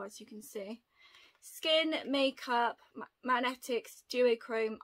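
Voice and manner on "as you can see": a playful whispered aside; confiding whisper. Real, not acted. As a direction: drop to a whisper for the joke. Whispered aside about purple.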